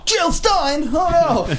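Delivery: high, hammed-up gasp of dismay; mock shock. Mocking a voter spotting Jill Stein, high mock dismay. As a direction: squeal it like a horrified voter.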